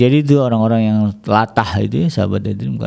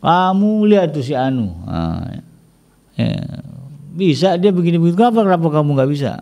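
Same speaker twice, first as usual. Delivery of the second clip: nagging parent; 6 semitones higher than usual. Retold or imitated. Parent comparing child to others.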